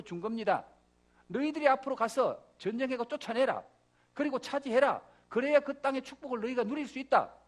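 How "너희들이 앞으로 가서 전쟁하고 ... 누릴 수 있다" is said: raised, firm voice of command; commanding God. Acted. God sending Israel into Canaan, commanding. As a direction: give marching orders from on high.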